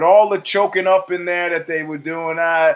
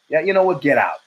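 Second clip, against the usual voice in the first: ordinary talk, nothing marked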